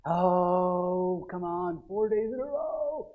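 put-upon moan, dragging out the words; grudging complaint. Acted. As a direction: groan it like a reluctant churchgoer. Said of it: "If that's your attitude".

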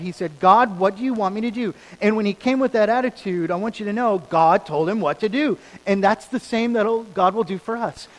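loud, high, driving sermon delivery; full-force preaching. Acted. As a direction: preach it with rising fire.

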